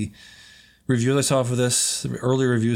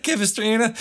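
laughing echo; 10 semitones higher than usual. Real, not acted. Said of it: "New York person".

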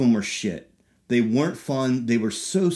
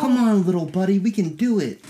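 coaxing little buddy; 7 semitones higher than usual. Pretend. Teasing encouragement of friend.

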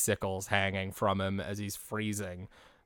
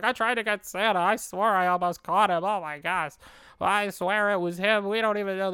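much higher, earnest muppet insisting; Big Bird voice; 10 semitones higher, 4 dB louder than usual. Retold or imitated. Big Bird telling the neighbours he almost caught Santa, high and earnest. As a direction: pitch it up and swear it was Santa.

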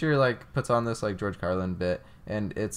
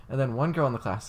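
usual speaking voice

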